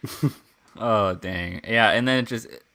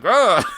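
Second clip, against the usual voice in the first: mock ugh; 10 semitones higher, 8 dB louder than usual. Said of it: "They're like".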